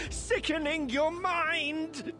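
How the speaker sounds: high, slow, drawn-out raving; crazed ranting. Acted. Madman ranting of a plague of nightmares, crazed.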